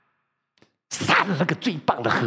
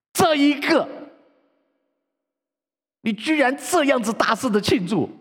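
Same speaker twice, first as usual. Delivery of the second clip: angry outburst; 12 semitones higher, 4 dB louder than usual. Acted. 大儿子向父亲抱怨.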